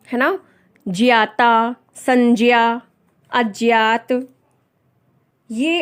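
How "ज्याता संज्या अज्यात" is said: ज्ञाता said as 'jyata', अज्ञात as 'ajyat'; prescribed pronunciation. Shown as a demonstration. Teacher modelling examples for learners, careful pronunciation. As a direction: separate words, j-y in संज्ञा, no g. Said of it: "तो जिया ही होगा ना".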